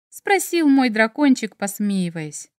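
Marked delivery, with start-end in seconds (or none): none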